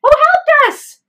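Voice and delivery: very high, loud shouts into the dark; screaming. Retold or imitated. Husband shouting into the empty moonlit road, screaming. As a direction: call out hard to an unseen helper. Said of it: "He's screaming".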